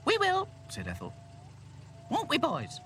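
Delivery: high, loud Ethel voice; Ethel replying. Acted. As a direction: answer high and emphatic. Ethel answers the man.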